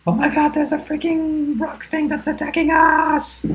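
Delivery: high, loud, drawn-out scream; shrieking in panic, words stretched out; screamed alarm. Acted. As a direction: scream a panicked warning to the party. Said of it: "I scream".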